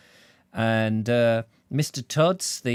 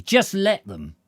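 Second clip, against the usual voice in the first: high, loud burst of defiance; indignant character's climactic cry; defiant outburst; 10 semitones higher, 7 dB louder, slower than usual. Acted. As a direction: dare them, voice jumping right up. Character's defiant outburst ends chapter.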